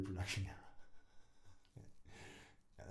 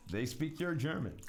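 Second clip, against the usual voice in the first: a light advertising slogan read, a touch punchier; mock ad tagline; 4 semitones higher, 4 dB louder than usual. Shown as a demonstration. Announcing the made-up tagline like an advert, mock ad voice. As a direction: sell it like a cheesy slogan. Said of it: "That could be a tagline".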